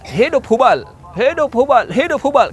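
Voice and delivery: high-pitched repeated cheer; victory cry. Acted. Abu Sufyan cheering victory to his troops, high triumphant cry. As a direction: hail the idol in triumph. Enemy commander's victory chant.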